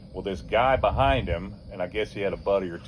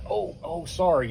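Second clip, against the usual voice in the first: high, fake sorry; mock sorry, higher; mock apology; 9 semitones higher, 3 dB louder than usual. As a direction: imitate a smirking apology. Mocking driver's fake apology.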